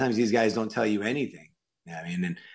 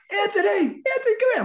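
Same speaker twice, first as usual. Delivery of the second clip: very high, loud imitated call; 5 dB louder than usual. Director spots actor at Oscar party, imitated high calling. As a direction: holler a name across a crowded party.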